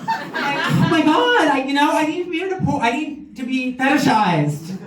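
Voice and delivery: vamp it up, needy and camp. Acted. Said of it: "guy with a lisp porn".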